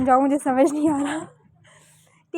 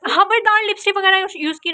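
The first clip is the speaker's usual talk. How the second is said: sharp warning shout; 6 semitones higher than usual. Real, not acted. Big sister shouting a warning over lipstick. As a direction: shout a threat to keep off.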